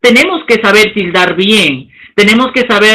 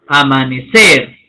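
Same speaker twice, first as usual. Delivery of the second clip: amanecer slow and low, weight on the final -cer; stress on last syllable; 8 semitones lower, slower than usual. Shown as a demonstration. Teacher demonstrating agudas to students, amanecer stressed on final -cer. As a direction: draw out amanecer and lean on cer. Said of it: "La curva asciende".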